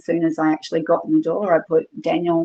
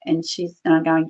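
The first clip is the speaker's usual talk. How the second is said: normal talking voice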